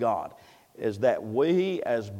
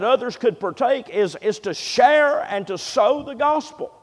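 high, raised pulpit voice; full-force preaching; 8 semitones higher, 8 dB louder than usual. Acted. Preacher asking why God left us here, impassioned. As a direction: press the questions home with rising fervor.

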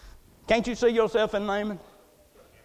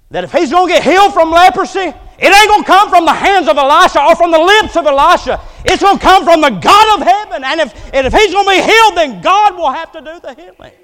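loud, high, driving sermon delivery; full-force preaching; 9 semitones higher, 13 dB louder than usual. Real, not acted. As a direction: drive the point home at full volume.

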